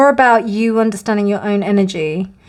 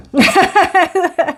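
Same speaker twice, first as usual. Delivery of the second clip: giggle; 8 semitones higher than usual. Real, not acted. Laughs after quoting card.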